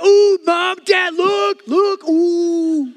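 very high, loud tattletale squeal; tattling kid. Retold or imitated. Sibling calling parents to catch a brother, high loud tattle. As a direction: sing it out like a kid telling on a sibling. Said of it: "they're like".

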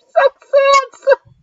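high, slow, sugary cooing; mock sugar baby. Host hamming up a sugar baby's flattery, high and slow. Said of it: "would be a very bad sugar baby".

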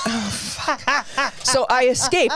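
laughing through the words; breathy laugh under the words. Real, not acted. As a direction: giggle while you say it. Laughing while telling.

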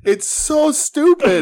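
high, slow disbelieving whine; exasperated. Real, not acted. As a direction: stretch it out in amused disbelief.

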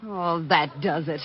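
low, slow, fed-up mutter. Acted. Employee fed up with her boss, low and slow. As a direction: let patience run out, slowly and low.

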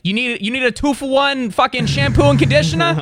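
high, hammed-up sales pitch; pushy salesman pitch. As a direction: pitch a bargain like a street hawker. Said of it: "And he's like".

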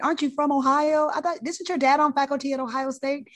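attending gushing to the new intern; excited gushing. Retold or imitated. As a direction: gush like a thrilled fan. Attending gushing over Ohio connection.

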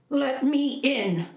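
high, slow call at the door; mother's high call. Acted. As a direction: call through the witch's door. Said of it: "the mother called".

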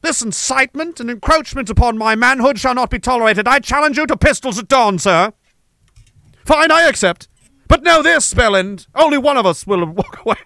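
a hammed-up formal duel challenge, proud and defiant; old-fashioned gentleman duellist. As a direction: challenge him like an offended aristocrat. Acted old-days duel challenge.

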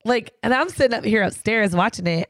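normal talking voice

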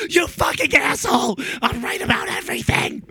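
high mock shout, playful; a friend mock-yelling he's right about everything. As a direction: yell it in jest at a friend. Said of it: "I was like".

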